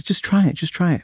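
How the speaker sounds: high, brisk, insistent urging; retold pushy encouragement; insistent coaxing. Director coaxing the reluctant actor.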